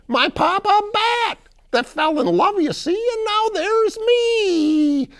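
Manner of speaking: very high, loud little-creature voice; Bakito. Acted. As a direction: tell your origins as an odd cartoon creature. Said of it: "I'm the Bakito".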